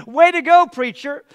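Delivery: loud, jeering "way to go" taunts from his own tears; mocking taunt. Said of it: "they're proponents against me going".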